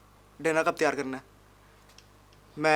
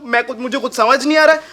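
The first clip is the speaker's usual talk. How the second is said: usual speaking voice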